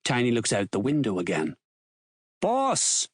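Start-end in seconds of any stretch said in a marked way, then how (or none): 2.4-3.1 high, sharp call to the boss; shout. A narrator's reading. Huey calls to his boss at the bar, shouting. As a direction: call out "Boss" high, without full volume. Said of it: "Huey shouts".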